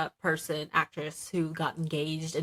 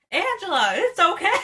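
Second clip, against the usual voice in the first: much higher, shouted through laughter; laughing mock reassurance; 10 semitones higher, 5 dB louder than usual. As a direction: squeal the husband's line while cracking up. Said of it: "But my favorite is".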